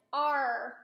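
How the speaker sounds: "R." said as a clear, drawn-out letter name; modelled letter R. Shown as a demonstration. Therapist modelling "R." for a child, clear R. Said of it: "Now say".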